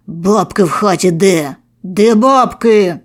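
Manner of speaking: slow, loud threatening demand; menacing voice. A narrator's reading. Narrator demanding money from the victim, slow and menacing. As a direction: lean in and growl the question. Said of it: "Запитую грізним голосом".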